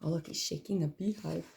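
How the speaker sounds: usual speaking voice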